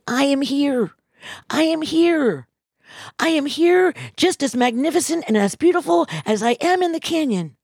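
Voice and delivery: a hint of a motherly goddess insisting; voice of Mother Earth. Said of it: "she came back and she says".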